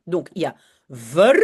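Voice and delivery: ver said slowly with an open vowel, syllable by syllable; slow syllable demo. Shown as a demonstration. Teacher breaking verschrikkelijk into syllables for francophones, slow demo. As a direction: spell out ver for the learners. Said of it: "je vais vous aider".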